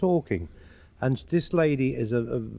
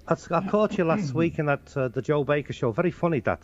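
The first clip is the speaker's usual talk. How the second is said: higher-pitched Scouse; Liverpool accent; 3 semitones higher than usual. Acted. Liverpool man who saw him on television, Scouse accent. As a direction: praise him as a Liverpool fan would. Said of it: "They have their own way of talking".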